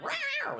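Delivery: a playful high mock roar; mock monster roar. Pastor mimicking people lashing out at the mirror, playful mock roar.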